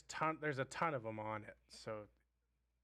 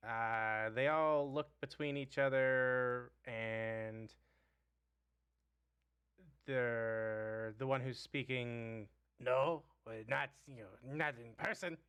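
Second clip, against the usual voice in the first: goblin voice. Acted.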